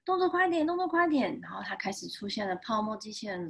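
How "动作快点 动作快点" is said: higher, hurried rallying voice; urgent urging. Acted. Foam car urges the heroes on, urgent high voice. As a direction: hurry the team along, pitched up.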